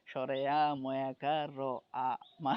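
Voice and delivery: slow spelling-out, piece by piece; childhood spelling recitation. Shown as a demonstration. Teacher recalling how children learn spelling, slowly spelling out piece by piece. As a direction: recite each letter deliberately, then join them. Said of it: "এইভাবে বানান করে করে শিখো".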